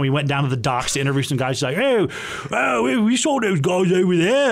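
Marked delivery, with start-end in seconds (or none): 1.8-4.5 higher-pitched imitation of a Cockney; Cockney accent. Game master voicing dock guys for players, imitated Cockney accent. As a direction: put on a rough attempt at Cockney. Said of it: "he had his Cockney accent".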